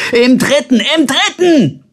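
high, urgent yell; bellowing. A narrator's reading. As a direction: holler the floor number after him. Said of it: "und brüllte".

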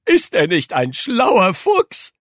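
high, loud, drawn-out cooing pride; proud housekeeper. A narrator's reading. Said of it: "Stolz kniff Frau Hagedorn". (stolze Haushälterin, hoch und gedehnt)

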